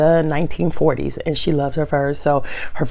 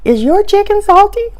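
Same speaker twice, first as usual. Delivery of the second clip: polite question; 13 semitones higher than usual. Retold or imitated. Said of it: "lean over to the lady and go".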